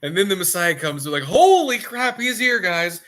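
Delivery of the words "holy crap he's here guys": high, loud alarmed demon; a demon's high, loud warning to the others; panicked demon. Retold or imitated. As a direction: sound the alarm like a minion.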